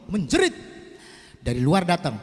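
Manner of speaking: ordinary talk, nothing marked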